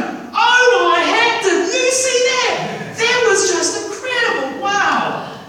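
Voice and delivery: very high, loud, slow squealing angel voice; giddy angels marveling at creation; excited angels. Acted. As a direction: gasp it out like awestruck angels. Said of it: "You imagine the angels going".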